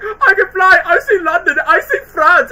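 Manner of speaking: high, loud giddy squeal with a laugh; the pig. Retold or imitated. Quoting the flying pig, high and delighted. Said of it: "when the pig was like".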